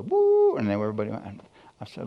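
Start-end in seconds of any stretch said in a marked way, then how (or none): 0.0-0.6 high-pitched whistle hoot; imitated whistle. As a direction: hoot like a work whistle blowing. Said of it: "then the whistle blew".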